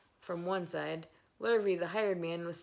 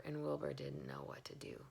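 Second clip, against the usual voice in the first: breathy whisper, nervous; whispered narration; 3 semitones lower, 9 dB quieter than usual. A narrator's reading. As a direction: hush it like a secret. Narrator whispers Wilbur's panic.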